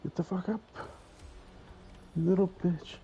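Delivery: high-pitched frustrated yell; yelling at the screen. Real, not acted. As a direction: shout at the boss to get up. Yelling at game character.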